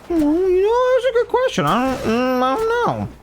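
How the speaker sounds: high-pitched put-on deliberation; mock pondering. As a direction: ham up agonizing over a simple question. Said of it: "I'll sit here and go".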